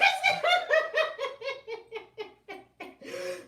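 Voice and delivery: high, long laughing fit. Real, not acted. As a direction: laugh so hard the makeup runs. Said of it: "Y'all gonna make me lose all my makeup".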